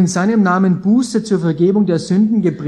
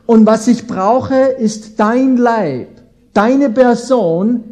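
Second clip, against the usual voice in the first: louder and higher, slow on dein Leib, deine Person; earnest plea; 4 semitones higher, 5 dB louder than usual. Acted. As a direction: plead slowly, word by word. Preacher voicing Jesus' plea.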